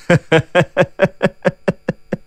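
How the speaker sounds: cold, staccato villain's chuckle; cold laugh. A narrator's reading. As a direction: sneer in a string of short, even laughs. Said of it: "这阴阳道冷笑几声".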